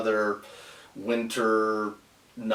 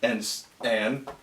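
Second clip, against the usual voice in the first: staccato; 3 semitones higher, much slower than usual. Shown as a demonstration. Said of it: "one word at a time".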